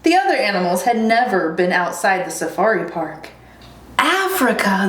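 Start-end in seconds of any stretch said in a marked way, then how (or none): 4.0-4.9 animals' higher, amazed cry; excited cry. Acted. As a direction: echo it in quick wonder. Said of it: "they cried".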